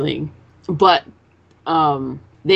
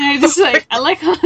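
high and loud through laughter; can barely get it out for giggling; laughing; 10 semitones higher, 6 dB louder than usual. Real, not acted. Retelling the sweating scene, laughing high and loud.

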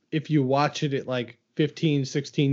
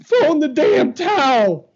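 much higher, louder and slower, a hammed-up cry; hammed-up yell at the other debater; mock yell; 12 semitones higher, 7 dB louder than usual. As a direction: bellow it like a heckler at ringside. Mock shouted rebuttal.

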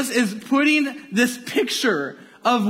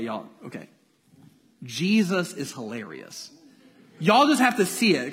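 usual speaking voice

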